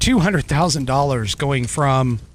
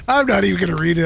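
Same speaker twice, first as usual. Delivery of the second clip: laughing; 2 semitones higher, much faster than usual. Real, not acted. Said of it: "I'm just laughing".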